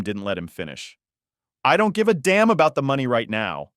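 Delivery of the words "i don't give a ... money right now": loud, higher angry outburst; heated, forceful snarl; angry growl. A narrator's reading. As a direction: cut him off furiously. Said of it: "he growled".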